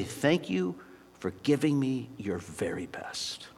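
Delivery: drops to a soft whisper on the thanks; hushed whisper. Retold or imitated. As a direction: whisper it like a treasured secret. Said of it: "gonna say something more like this".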